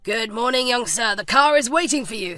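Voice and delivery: lightly voiced driver's courteous greeting; a hint of a dutiful chauffeur announcing the car. A narrator's reading. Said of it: "The driver, Wilson".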